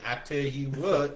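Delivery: slow Southern drawl, I tell you what; Southern accent. Retold or imitated. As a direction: drawl it like a Texan. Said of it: "like she's from the South".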